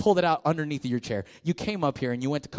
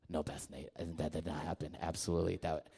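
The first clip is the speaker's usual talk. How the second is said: put-on low, much softer girl's voice; imitating teen girl; 11 semitones lower, 14 dB quieter than usual. Pastor imitating a girl blaming the devil, softly. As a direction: hush it like an embarrassed teen. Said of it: "and she goes".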